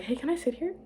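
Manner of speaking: high-pitched, tentative little question; tentative approach. Retold or imitated. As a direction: ask it high, as if testing the water. Acting out shy approach to crush.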